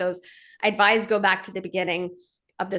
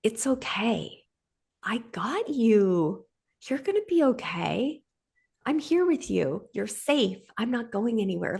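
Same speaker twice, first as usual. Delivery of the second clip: comforting parent; 3 semitones higher, slower than usual. Pretend. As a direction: hold a scared child with your voice. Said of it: "somebody to come and say to you".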